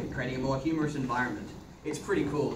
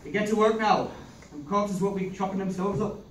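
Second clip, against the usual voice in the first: put-on Irish accent. Man in Irish accent fussing over a bloodied girl. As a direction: play it broad and rural Irish.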